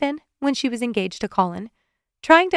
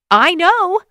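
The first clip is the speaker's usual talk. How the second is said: much higher, loud shouted retort; angry shout; 9 semitones higher, 9 dB louder than usual. A narrator's reading. Amanda shouts back at laughing Evan, angry and loud. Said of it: "Amanda shouted back at him".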